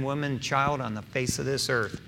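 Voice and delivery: usual speaking voice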